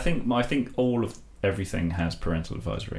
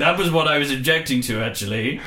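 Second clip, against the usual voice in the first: higher-pitched put-on royal voice; Prince Philip; 6 semitones higher than usual. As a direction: play a royal addressing a young man. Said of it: "I'm Prince Philip".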